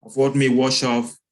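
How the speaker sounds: measured poem recital with long pauses; poem reading. Acted. Poet reads poem on a plane crash to an audience, solemn recital. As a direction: read it out firmly, line by measured line.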